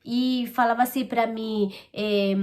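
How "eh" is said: normal talking voice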